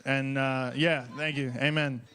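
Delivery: usual speaking voice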